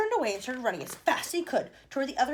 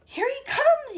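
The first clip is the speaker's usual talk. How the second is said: high, loud yell; 11 dB louder, much slower than usual. A narrator's reading. Wonka yelling as Mike appears. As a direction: yell it out excitedly. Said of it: "yelled Mister Wonka".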